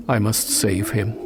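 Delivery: ordinary delivery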